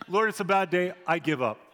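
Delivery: a loud, hammed-up plea of despair; loud despairing cry. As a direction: cry out as if at the end of your rope. Voicing a despairing person giving up.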